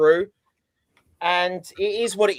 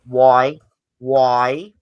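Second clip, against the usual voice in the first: exasperated; 4 semitones lower, 6 dB louder than usual. Retold or imitated. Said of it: "I just went".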